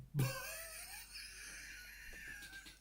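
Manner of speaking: squealing laugh. Real, not acted. Laughing fit over the film.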